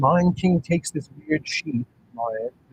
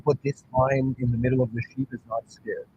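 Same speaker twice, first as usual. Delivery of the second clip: ordinary talk, nothing marked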